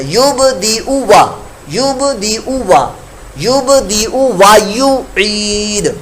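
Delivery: یبدئ with short o, gliding straight into وا, slowly; slow Arabic drill, short o into waw; short o then waw. Shown as a demonstration. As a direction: say یبدئ وا without lengthening the o. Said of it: "او کی ادائیگی کرتے ہی آگے واو پڑھنا ہے".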